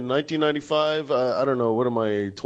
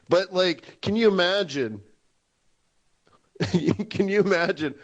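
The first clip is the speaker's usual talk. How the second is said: ordinary delivery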